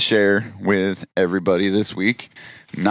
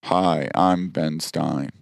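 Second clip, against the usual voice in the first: imitating Ben Stein's monotone invite; 7 semitones lower, 5 dB quieter than usual. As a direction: drone it like a bored game show host. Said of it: "by being monotone".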